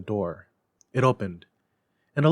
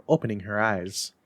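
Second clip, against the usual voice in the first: plain reading voice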